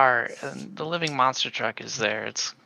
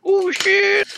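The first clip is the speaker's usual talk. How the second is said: shocked yelp; 9 dB louder than usual. Acted. Sudden alarm at date news.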